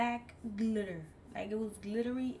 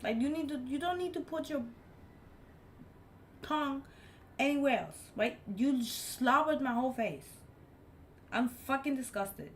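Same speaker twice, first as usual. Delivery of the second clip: raised, exasperated telling-off; heated mock rant; disgusted rant; 5 semitones higher, 3 dB louder than usual. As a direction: scold him for slobbering. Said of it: "And I'm like".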